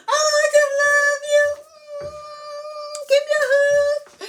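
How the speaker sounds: very high, slow, gushing voice; high sweet voice. Real, not acted. To her viewers, a gushing high-pitched love voice. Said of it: "I don't know why my voice do like that".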